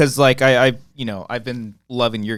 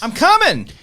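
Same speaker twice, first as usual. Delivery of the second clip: excited call, much higher; 13 semitones higher, 4 dB louder than usual. Real, not acted. Playfully announcing he's coming.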